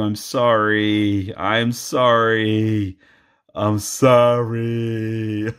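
slow drawn-out mock apology; slow mock sorry. Vlogger apologising to the footballer, in a slow mock plea. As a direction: stretch each sorry out, hamming it.

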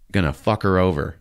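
usual speaking voice

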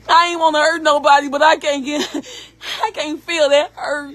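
tearful, breaking voice; crying. Real, not acted. Mother explaining she didn't want to hurt anyone, crying. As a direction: push the words out through crying.

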